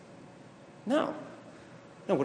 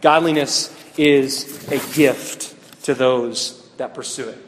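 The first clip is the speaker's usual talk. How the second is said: slow, spelled-out dictation pace; 3 dB louder, slower than usual. Shown as a demonstration. Pastor dictating the fill-in blank to the youth group, slowly. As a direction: read it slowly so they can fill in the blank. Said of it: "So that's your next blank".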